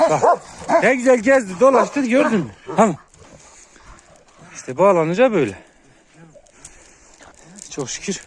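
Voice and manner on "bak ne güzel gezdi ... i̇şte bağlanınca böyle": loud, high worked-up voice; real frustrated raised voice; exasperated loud complaint. Real, not acted. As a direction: vent to viewers still agitated. Complaining to viewers loudly.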